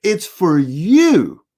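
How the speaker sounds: slow, drawn-out YOU; slow and pointed, leaning on "you"; pointed hint. Retold or imitated. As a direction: stretch out "you" so the hint lands. Retold hint to the slow-catching neighbor.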